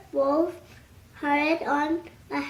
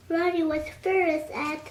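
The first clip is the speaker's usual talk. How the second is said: ordinary delivery, nothing marked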